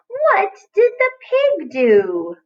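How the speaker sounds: high, slow, sing-song question; wondering storytime voice; playful high slow reading. Shown as a demonstration. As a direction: stretch the question out like a game for kids. Teacher rereads the sentence playfully.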